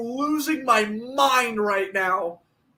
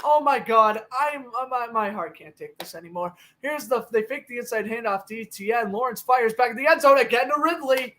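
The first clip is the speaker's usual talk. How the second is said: usual speaking voice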